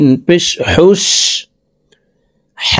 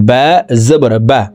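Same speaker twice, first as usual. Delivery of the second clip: বা said short and quick, no stretch; short quick vowel. Shown as a demonstration. Teacher showing students the short fatha, said quick. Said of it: "তো আমরা এই বাকে দ্রুতভাবে পড়ব".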